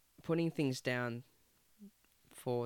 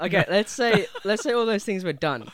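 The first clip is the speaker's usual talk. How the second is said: loud insistent; 7 semitones higher, 13 dB louder than usual. Real, not acted. Laughing loud push.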